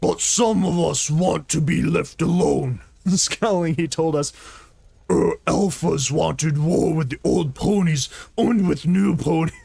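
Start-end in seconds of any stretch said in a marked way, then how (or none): 0.0-2.8 orc voice. Acted. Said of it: "make him sound like an orc". 5.1-9.5 orc voice. Acted. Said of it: "make him sound like an orc".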